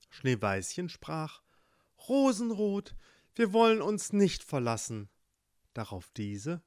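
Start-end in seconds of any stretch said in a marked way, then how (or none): 2.1-5.1 put-on high, louder girlish voice, earnest; young girl. Schneeweißchen pledges loyalty to Rosenrot, high girlish earnest. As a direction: promise your sister you'll never leave. Girl promises her sister.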